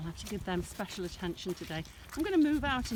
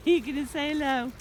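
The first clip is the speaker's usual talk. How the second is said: louder, sing-song coaxing; pet-talk coax; 4 semitones higher, 6 dB louder than usual. Real, not acted. Owner coaxing her arthritic sheep Angus, sing-song pet-talk.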